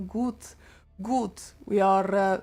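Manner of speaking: halting try at a German accent; unsure, Russian-sounding German accent; attempted German accent. Shown as a demonstration. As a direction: feel your way into a German accent. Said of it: "How do we do German accent?".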